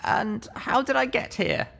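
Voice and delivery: high, wary question; a hurt young mare, uneasy; young mare's voice. Acted.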